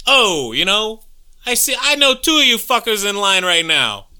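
put-on New York Bronx accent, shouting at friends in a line. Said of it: "that's definitely some Bronx action".

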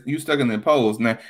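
usual speaking voice

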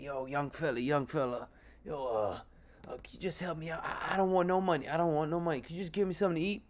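homeless man begging. Retold or imitated. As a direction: quietly ask a stranger for food. Homeless man asking for food.

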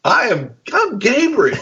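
high, insistent mock angel voice; exasperated angel. Angel to Zechariah, high exasperated insistence. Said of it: "he says".

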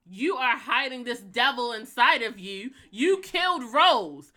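loud, slow, high-pitched confrontation; acted-out accusation. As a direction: accuse her, hammering out each word slowly and loudly. Acts out confronting Raven.

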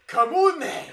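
high, very loud Glaswegian yell; thick Glaswegian accent. Acted. Host mimics Scottish guy breaking into British chat, Glaswegian shout. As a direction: roar a mock Glaswegian challenge. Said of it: "really thick Glaswegian accent".

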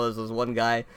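normal talking voice

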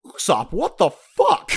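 high, loud, drawn-out disbelief; outraged shout. Acted. A fan scolding Usopp for his line, outraged. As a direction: yell it at him, appalled. Speaker's own outburst at Usopp.

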